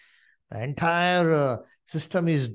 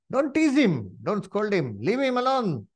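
high, raised-voice protest; thief's rebuke; 6 semitones higher than usual. Acted. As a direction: call it out firmly in Jesus's defence. Thief defends Jesus from mockers.

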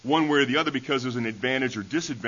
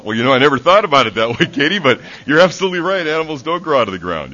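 put-on loud, laughing reply to the child; amused realization; 3 semitones higher, 11 dB louder than usual. Teacher tells Katie she is right, laughing and much louder. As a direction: laugh through the surprised admission.

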